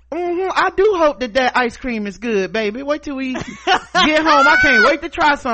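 high, sugary nosy lady voice; Karen voice. Retold or imitated. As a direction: play a nosy chatty shopper. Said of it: "Karen will be over there like".

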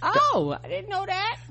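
very high, sudden oh; squeaky mock surprise. Real, not acted. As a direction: feign shock.

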